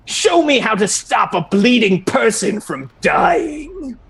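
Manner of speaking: high, commanding challenge; demanding examiner. Acted. Examiner demanding first aid after wounding someone, imperious.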